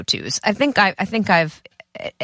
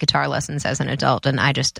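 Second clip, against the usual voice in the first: ordinary delivery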